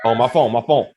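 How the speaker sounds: ordinary talk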